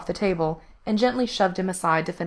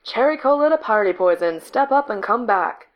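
louder, higher call over the radio; 4 semitones higher, 4 dB louder than usual. A narrator's reading. Cherry Cola calls Party Poison over static, radio call.

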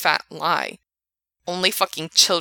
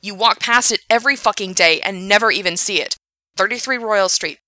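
angry rant; 2 semitones higher, 6 dB louder than usual. Acted. Frank ranting at Gerard.

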